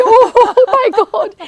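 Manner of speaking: high, loud laughing gasp, slowed down; laughing teacher. Retold or imitated. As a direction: burst out laughing in awe. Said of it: "the teacher would be".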